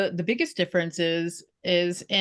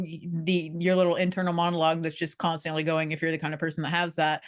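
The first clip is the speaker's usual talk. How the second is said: normal talking voice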